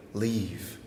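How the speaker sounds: low, hushed single-word urging; quiet, low warning; voice in your ear. Acted. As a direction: say it softly, close to the ear. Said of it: "sweaty voice in your ear saying".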